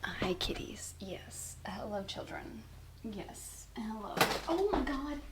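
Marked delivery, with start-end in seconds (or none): 0.0-4.1 hushed, lower pet talk; soft greeting to cats. Real, not acted. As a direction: coo softly at the kitties.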